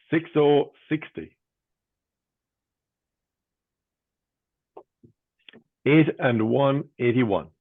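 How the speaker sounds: sing-song bingo number calling; bingo caller. Real, not acted. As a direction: lift the first half, drop the second.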